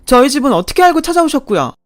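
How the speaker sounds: high, loud outraged questioning; furious. Acted. Daughter-in-law confronts mother-in-law over the birth registration, furious. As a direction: confront her in a loud, rising fury. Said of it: "피가 머리 끝까지 솟구치는 심정".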